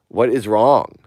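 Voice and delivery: loud, frustrated question; exasperated shout. Real, not acted. Exasperated outburst.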